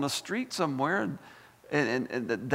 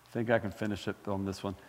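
ordinary talk

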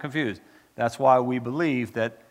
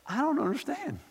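high, softer put-on voice; pleading questioner; 14 semitones higher, 5 dB quieter than usual. Preacher imagining himself with questions in heaven, pleading questioner.

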